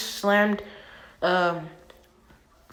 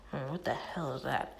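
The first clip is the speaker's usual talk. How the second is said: much lower, much softer puzzled murmur; quiet inner thought; 6 semitones lower, 14 dB quieter than usual. Acted. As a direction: say it low under your breath, baffled. Naruto's inner confused thought.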